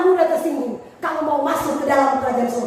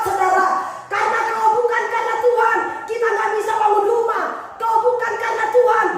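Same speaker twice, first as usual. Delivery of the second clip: full-force preaching; 8 semitones higher than usual. Acted. Preacher exhorts the congregation to remember God, at full force. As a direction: drive every line home like a revival preacher.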